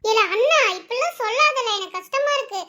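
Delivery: lightly voiced sister, pitched a little high; protesting little sister. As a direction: gently protest to big brother as his sister. (உயர்ந்த குரலில் அண்ணனிடம் பேசும் தங்கை)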